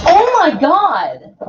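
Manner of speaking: high, loud and slow oh my God; drawn-out real shock; startled cry at the broken light; shocked exclaim. Real, not acted. Part falls off the light.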